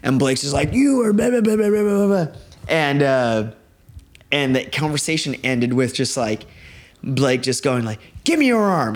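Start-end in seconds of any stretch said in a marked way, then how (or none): 0.7-2.3 high-pitched imitation of a rant; mock ranting. Retells friend ranting at musician. 8.2-8.9 high-pitched retold demand; mock command. Retells friend's demand.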